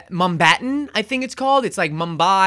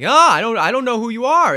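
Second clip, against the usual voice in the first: louder, higher, defensive jealous snap; jealous outburst; 5 semitones higher, 7 dB louder than usual. Retold or imitated. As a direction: bristle at the rival. Jealous Miles snaps at Hobie.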